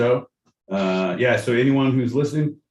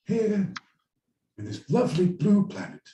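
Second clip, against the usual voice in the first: David Attenborough; 9 semitones higher than usual. Acted. Host mimics the documentary narrator on the blue planet, high hushed impression. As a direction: voice a reverent wildlife presenter. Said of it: "I believe that was David Attenborough".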